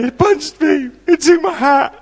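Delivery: put-on high, tearful kid voice; hurt boy telling his dad what happened; crying child. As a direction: blurt it out like a sobbing eleven-year-old. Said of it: "tried to explain".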